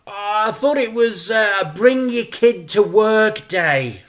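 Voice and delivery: high, loud sheepish joke; joking hopefully. Acted. Dad jokes to calm the crooks, high and loud. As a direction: try to soften them with a joke. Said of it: "clearly hoping a joke might soften them".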